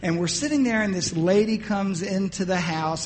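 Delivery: ordinary delivery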